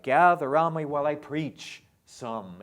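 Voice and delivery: loud, with SOME given an accent; stress on SOME. Shown as a demonstration. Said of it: "sound like".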